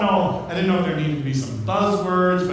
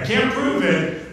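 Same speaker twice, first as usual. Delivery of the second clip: normal talking voice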